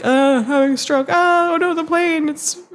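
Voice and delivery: high hammed-up pilot faking a stroke; faked stroke. Pilot pretends a stroke to the passengers, high and hammy. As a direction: ham up a fake medical emergency. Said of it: "make it look like".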